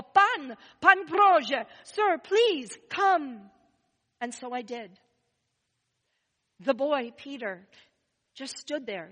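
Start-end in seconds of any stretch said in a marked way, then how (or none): 0.0-3.5 loud, high call from outside; father calling Santa from outside, loud and high; man calling. Retold or imitated. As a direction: shout for the gentleman to come. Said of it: "I heard a man's voice call".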